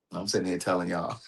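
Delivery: holding back tears while telling the viewers; choked up. Real, not acted. Telling viewers about a friend's call, choked up and soft.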